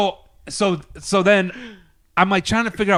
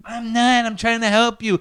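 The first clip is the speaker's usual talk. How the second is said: imitated protesting woman; 5 semitones higher than usual.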